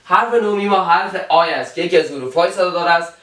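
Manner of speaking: ordinary delivery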